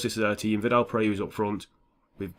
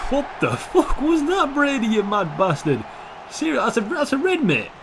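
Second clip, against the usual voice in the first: much higher, laughing, outraged at the foul; squeaky outrage; 11 semitones higher, 4 dB louder than usual. Real, not acted. Gamer squealing at a foul, outraged and high. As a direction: pitch way up in disbelief. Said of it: "I don't know why I'm talking like that".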